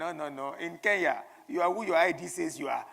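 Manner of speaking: loud, firm correction. Retold or imitated. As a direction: set him straight firmly. Retold reply of the Kenyan lady.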